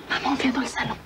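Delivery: low, hushed plea; conspiratorial whisper; urgent whisper. Acted. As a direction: whisper a secret favour to your mother. Secret plea to mother.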